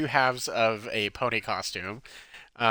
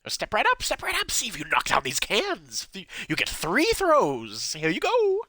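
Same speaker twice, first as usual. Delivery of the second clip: carnival barker; 9 semitones higher than usual. Acted. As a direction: hawk the game like a carnival barker. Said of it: "he points at".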